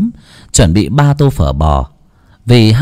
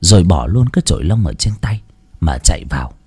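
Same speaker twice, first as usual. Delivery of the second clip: ordinary delivery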